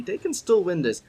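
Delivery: ordinary delivery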